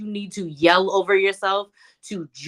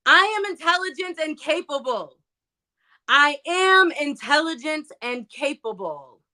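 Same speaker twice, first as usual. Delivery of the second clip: yelled affirmation; 7 semitones higher than usual. Shown as a demonstration. Speaker demonstrating yelling it in the mirror, high projected affirmation. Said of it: "stand in the mirror and yell out".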